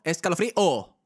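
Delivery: escalofrío con la última O alta y golpeada; O final marcada. Shown as a demonstration. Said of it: "aunque tú marques esa O". (escalofrío con acento musical en la o)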